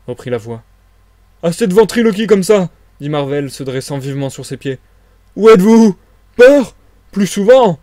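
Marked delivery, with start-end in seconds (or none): 1.4-2.7 high, loud exasperated outburst; fed-up shout at someone unseen; angry challenge. Acted. 5.4-7.8 high, very loud scornful challenge; loud, indignant bursts; defiant shout. Acted. Marvel challenges the unseen voice.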